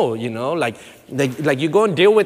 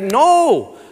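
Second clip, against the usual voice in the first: emphatic no; 10 semitones higher, 8 dB louder than usual. Acted. Emphatic refusal mid-sermon.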